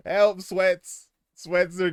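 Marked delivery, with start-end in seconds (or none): 0.1-0.4 high parrot help through laughter; laughing parrot imitation. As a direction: squawk it while laughing. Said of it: "learned to say".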